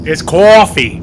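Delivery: loud, high New York "cawfee"; mock New York accent. Correcting friends on the accent, exaggerated New York. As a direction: belt out "coffee" in a hammed-up New York accent. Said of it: "that's the New York, New York accent".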